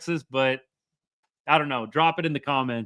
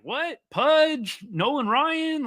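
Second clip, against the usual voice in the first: much higher-pitched, incredulous imitation of upset fans; mock outrage; 11 semitones higher, much slower than usual. Fans protesting his pick of favorite Ranger, mock outrage. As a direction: imitate indignant fans in a high, disbelieving voice. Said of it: "some people get mad".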